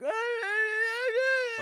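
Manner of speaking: high, drawn-out shouted wail; angry wailing outburst. Retold or imitated. Angry passenger can't recline his seat, shouting high wail. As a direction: let out a furious frustrated howl. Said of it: "He's like".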